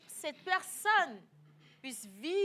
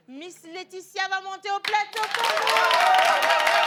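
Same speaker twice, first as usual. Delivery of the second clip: announcer; 6 semitones higher, 14 dB louder, slower than usual. Acted. Host introducing contestant.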